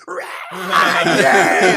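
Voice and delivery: loud, high cheering shouts; crowd cheering. Retold or imitated. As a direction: cheer like a whole crowd of scouts.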